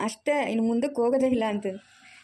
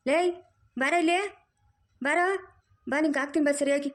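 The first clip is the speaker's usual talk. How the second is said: calling out; 6 semitones higher than usual. Acted. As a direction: summon her sharply. Mother-in-law calls her over.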